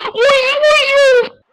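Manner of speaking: outraged disbelief. Real, not acted. Reacts to conceded goal.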